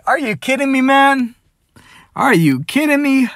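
exasperated disbelief, voice up high; incredulous. Retold or imitated. Confronting the girl about her pregnancy, high exasperated disbelief.

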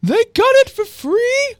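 very high squeaky guess; slow falsetto question; C C's voice. Acted. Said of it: "I forget what C C's voice is".

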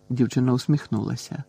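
plain reading voice

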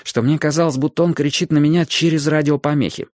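ordinary delivery, nothing marked

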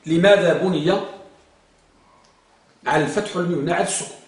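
ordinary talk, nothing marked

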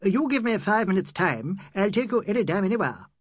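reply as every inch the Englishman; flustered Eurasian playing Englishman. A narrator's reading. Eurasian chauffeur rallying to his master, trying to sound English. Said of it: "every inch an Englishman replied".

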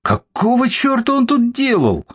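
high, furious cry; furious exclamation. Acted. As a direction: shout it in rage. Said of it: "с яростью воскликнул Борис". (яростный возглас)

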